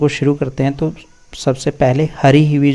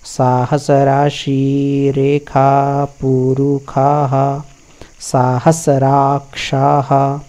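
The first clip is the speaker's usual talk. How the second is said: slow recitation; much slower than usual. Shown as a demonstration.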